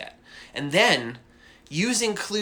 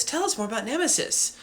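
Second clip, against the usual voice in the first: imitating eager commenters; 8 semitones higher, 4 dB quieter than usual. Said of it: "There's always like".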